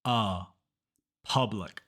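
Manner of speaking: Uh, then Public as "PUH-blic"; unrounded uh. Shown as a demonstration. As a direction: model the uh of Public, falling. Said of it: "don't round your lips".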